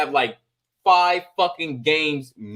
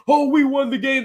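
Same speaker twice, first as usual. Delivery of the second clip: high, put-on gloating; mock boasting player; 9 semitones higher than usual. Player thinking he won the game, mock-gloating. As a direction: crow it in a pitched-up voice. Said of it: "Motherfuckers be thinking".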